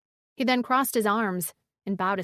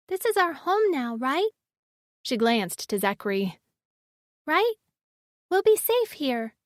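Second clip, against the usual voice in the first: put-on high, hopeful little girl voice; 5 semitones higher than usual. A narrator's reading. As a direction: ask like a small child seeking comfort. Child voice of Riven.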